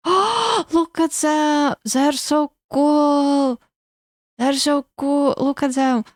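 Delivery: high delighted gushing; excited. Real, not acted. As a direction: squeal with delight. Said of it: "excited at ghost design".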